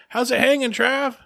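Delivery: high, chummy mock greeting; teasing kid friend. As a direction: imitate a kid ribbing his buddy. Friends tease him in the story.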